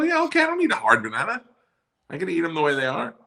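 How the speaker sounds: very high mocking squeak; high sarcastic. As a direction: scoff at her in a squeak. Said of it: "I go".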